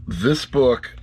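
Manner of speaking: normal talking voice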